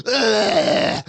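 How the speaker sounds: loud, long strained grunt; hammed-up high grunt of exertion; straining groan. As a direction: groan with all your effort. Acting the squeezing strain.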